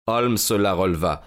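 ordinary delivery, nothing marked

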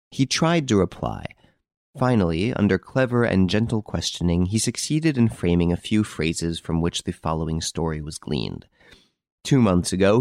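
ordinary delivery, nothing marked

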